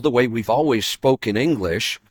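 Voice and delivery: ordinary talk, nothing marked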